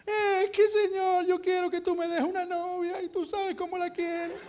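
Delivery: high, whimpering plea; pitiful whining. Retold or imitated. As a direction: beg God for a girlfriend pitifully. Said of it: "vamos delante de Dios dando lástima".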